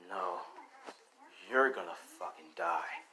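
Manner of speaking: low, soft, understated menace; quiet threat. Acted. Deku threatens his opponent.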